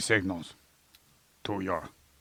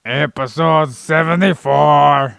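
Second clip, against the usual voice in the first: loud, higher, showy call; belted episode announcement; 6 semitones higher, 9 dB louder, slower than usual. Acted. Host announces the corrected episode number, loud and high.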